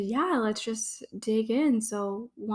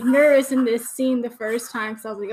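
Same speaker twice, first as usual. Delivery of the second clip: ordinary delivery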